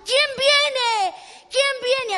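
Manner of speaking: loud, high calling out; insistent asking. Acted. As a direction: keep demanding an answer from passers-by. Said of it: "Y seguía preguntando". (pregunta insistente a gritos)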